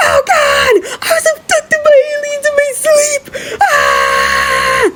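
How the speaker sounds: high, crying shriek of terror; panicked scream. Acted. Thumbelina waking up kidnapped at the pond, shrieking in terror. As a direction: wail in shrill panic.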